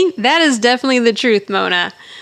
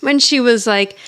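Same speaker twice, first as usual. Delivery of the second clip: ordinary delivery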